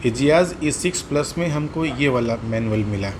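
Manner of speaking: ordinary delivery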